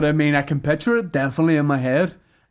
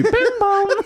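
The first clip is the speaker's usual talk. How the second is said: high sing-song "bing bong"; high imitated bell; tannoy chime. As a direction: chime the announcement off through a laugh.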